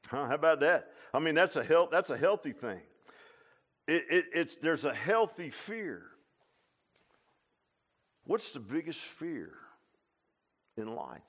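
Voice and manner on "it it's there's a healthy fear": ordinary talk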